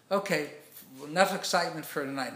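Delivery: acted firm, loud command; bossy schoolmaster. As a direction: cut off the fun like a schoolmaster. Schoolmaster ends the children's celebration.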